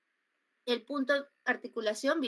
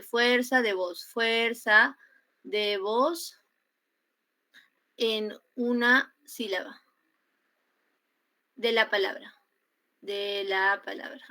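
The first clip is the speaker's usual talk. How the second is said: slow dictation; 5 dB louder, slower than usual. Shown as a demonstration. Teacher dictating while writing the definition.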